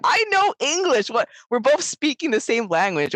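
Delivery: high-pitched, baffled protest; exasperated protest. Retold or imitated. Protesting to British speakers she cannot follow, exasperated. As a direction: protest in disbelief at the top of your range. Retold protest at not understanding.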